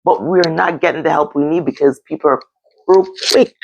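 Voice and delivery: normal talking voice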